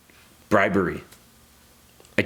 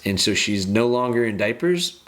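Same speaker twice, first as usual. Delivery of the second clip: normal talking voice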